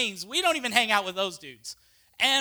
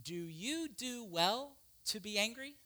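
gentle question; 12 dB quieter than usual. Acted. God's question to Jonah.

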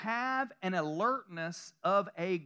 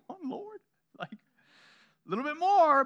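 playful complaint; 7 semitones higher, 6 dB louder than usual. Pretend.

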